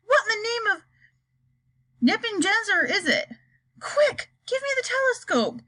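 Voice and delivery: high urgent yell. Acted. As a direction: shout for the telescope. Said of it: "yelled Shuckworth".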